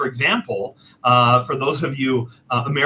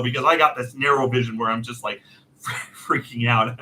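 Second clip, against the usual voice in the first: usual speaking voice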